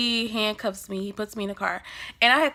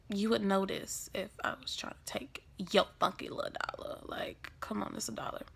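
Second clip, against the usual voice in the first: very soft grumbling aside; muttered retort; 12 dB quieter than usual. Retold or imitated. Handcuffed teen to cop about bait dollar, muttered retort. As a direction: grumble it under your breath.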